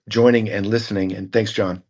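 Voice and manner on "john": ordinary delivery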